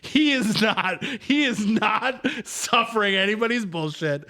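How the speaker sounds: a hint of a French accent, slow and high; mock French accent. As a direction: lightly mimic the annoyed Frenchman's French accent. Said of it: "he's got a thick French accent".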